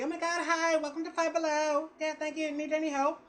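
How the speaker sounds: high sing-out greeter voice; imitation of an over-friendly shop greeter; store greeter lady. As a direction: put on a high saleslady welcome. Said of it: "they always got the lady".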